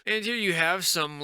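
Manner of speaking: ordinary talk, nothing marked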